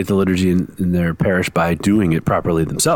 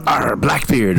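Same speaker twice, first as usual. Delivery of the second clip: slow, laughing pirate growl; hammy pirate calling out a name; 4 semitones higher, slower than usual. Pretend. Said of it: "every time you name the name of a pirate".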